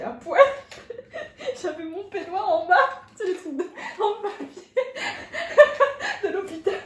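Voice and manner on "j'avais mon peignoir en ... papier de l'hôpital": high and loud, laughing all through; barely able to speak for laughing. Real, not acted. Laughing hard as she tells her friend about her teeth.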